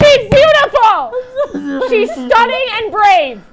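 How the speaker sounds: high, slow, loud gushing with a laughing cry; gushing praise. Acted. Host hyping up a celebrity, loud gushing high praise. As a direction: overdo the adoration, nearly weeping.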